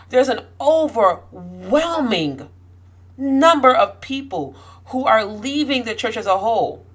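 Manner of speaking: worked up, hammering the key words; getting angry. Real, not acted. Vlogger worked up about people pushed out of church, getting angry. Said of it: "I'm getting mad. I'm getting angry".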